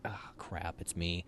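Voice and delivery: a hushed "oh no" to yourself; quiet dread. Retold or imitated. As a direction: mutter it under your breath. Dread realizing he's called.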